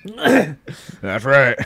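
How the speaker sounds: put-on singer impression. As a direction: cough into a put-on voice, at ordinary volume. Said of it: "He doesn't talk like that".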